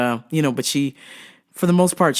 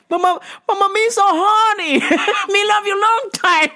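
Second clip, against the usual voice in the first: high-pitched caricature through laughter; mock film-character voice; 3 dB louder than usual. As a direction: put on a high, hammed-up movie quote voice. Mocking a Vietnam-era film line.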